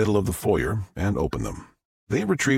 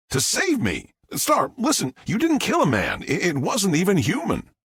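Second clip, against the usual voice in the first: higher-pitched, emphatic reassurance; urgent insistence; 10 semitones higher than usual. A narrator's reading. Jack tells Star it wasn't human, urgently insistent. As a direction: press hard to convince a distressed friend.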